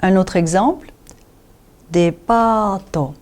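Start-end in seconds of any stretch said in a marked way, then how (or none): 1.9-3.1 slow depato, the pa held out; long A in depato. Shown as a demonstration. Teacher gives another katakana example, long A in depato. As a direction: stretch the middle A of depato. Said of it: "le prolongement des voyelles longues".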